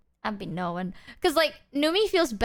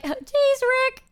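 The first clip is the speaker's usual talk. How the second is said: high mock exasperation; 14 semitones higher, 3 dB louder than usual. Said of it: "I was like".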